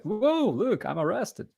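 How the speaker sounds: high, chirpy mock excitement; imitating a cheerful selfie. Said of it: "And he's like".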